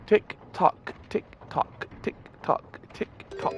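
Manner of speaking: whispered clock ticking; soft, evenly spaced, dying away; hushed ticking. Acted. Voicing ticking clock in game.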